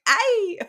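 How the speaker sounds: high mock yelp of pain. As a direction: yelp as if something just got pulled. Imitating hero's sudden injury cry.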